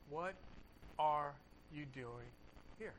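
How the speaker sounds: slow, soft, hushed question; a still small voice, unhurried and soft; low whisper of God. Acted. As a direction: let God's voice sink to a slow murmur. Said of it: "the sound of a low whisper".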